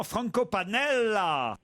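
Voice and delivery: slow, drawn-out Italian flourish on the name; showy Italian accent. Acted. Host showing off to his Italian caller, hammed-up Italian accent. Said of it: "l'accent italien".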